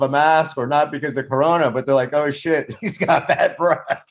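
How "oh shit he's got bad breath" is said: joking quote broken up by laughter; laughing. Real, not acted. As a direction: crack up while delivering the punchline. Cracks up at his own joke.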